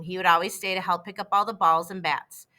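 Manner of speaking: ordinary delivery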